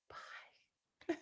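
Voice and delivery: breathy whispered goodbye. Real, not acted. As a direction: whisper it like a secret farewell.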